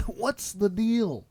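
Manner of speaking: slow, drawn-out "What's the deal?" with a laugh in it; stand-up comic voice. Pretend. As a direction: stretch the question like an observational comic, laughing.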